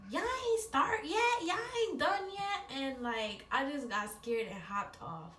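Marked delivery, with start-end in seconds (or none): 0.1-2.8 high-pitched teasing call; high teasing sister voice. Retold or imitated. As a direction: tease them through the door. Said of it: "and was like".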